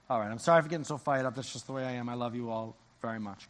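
usual speaking voice

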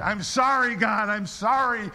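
loud, high plea for forgiveness; retold impassioned apology to God; heartfelt prayer. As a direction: cry out in repentance, slowing at the end. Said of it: "I prayed my heart out".

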